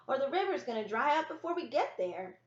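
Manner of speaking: put-on high, loud impatient child's call; calling from down the path. Impatient brother calls up the path to Ellie, high and loud. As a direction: shout it to her from further down the trail. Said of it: "Samuel said from down the path".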